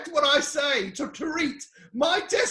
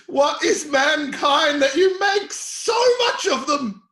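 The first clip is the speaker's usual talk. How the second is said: ordinary talk, nothing marked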